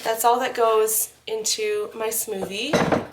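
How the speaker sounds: ordinary talk, nothing marked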